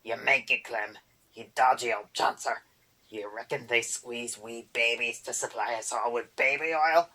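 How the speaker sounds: loud goblin screech; boggart screech. A narrator's reading. Boggart screeches at Erder, loud goblin insult. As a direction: spit the insult twice.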